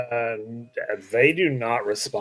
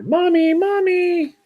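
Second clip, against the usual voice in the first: put-on child calling out; 7 dB louder, slower than usual. Girl calling mother from afar.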